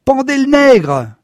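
screamed high and loud from the crowd; shrill yell. A narrator's reading. Woman in the crowd screaming for a lynching, a high yell.

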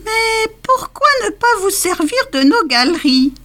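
voix de souris très aiguë; voix du roi des souris. A narrator's reading. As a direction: proposer d'une petite voix perchée. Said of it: "proposa la souris". (le roi des souris propose ses galeries)

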